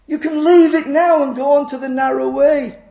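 high, loud urging; calling out. Acted. Roadside voice offering the narrow way to travellers, calling out. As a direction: call across the road, pitched high. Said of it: "this voice calls out".

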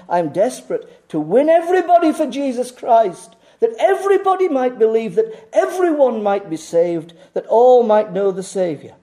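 loud, high, urgent appeal; emphatic plea. Real, not acted. Preacher pleading with his congregation, high and urgent. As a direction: pour out desperate longing. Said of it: "I could not be more emphatic".

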